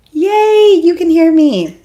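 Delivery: real delight, high and loud; excited. Real, not acted. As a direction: celebrate that the sound finally works. Relieved the stream audio works.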